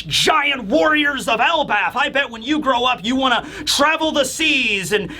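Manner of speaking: high-pitched, eager reassurance building into grand hype; rousing pep talk. Acted. Usopp hyping future giant warriors, rousing and eager.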